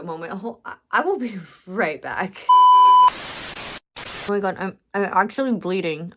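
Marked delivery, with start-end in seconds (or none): 2.5-3.8 a sudden high, loud cry of pain on "Oh"; pained yelp. Real, not acted. Vlogger crying out after biting her lip hard, a loud pained yelp. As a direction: yelp "Oh" as the bitten lip stings.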